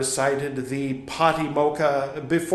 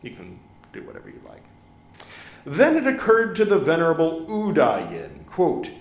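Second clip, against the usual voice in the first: usual speaking voice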